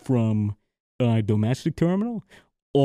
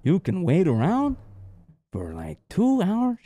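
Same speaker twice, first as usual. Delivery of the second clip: imitated Mexican accent. Manager warning stranded traveler about check-in, put-on Mexican accent. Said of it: "the way you said that".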